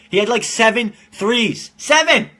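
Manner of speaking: high, loud hype yell on seven; excited hype. Real, not acted. Bragging to viewers about Joe's seven threes, excited. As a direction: shout the number like a hype man. Hyping friend's shooting.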